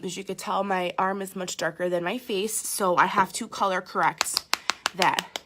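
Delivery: normal talking voice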